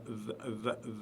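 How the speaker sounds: "th" buzzed softly between the teeth; "th" a quiet, voiced buzz; Arabic dh. Shown as a demonstration. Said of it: "c'est le th".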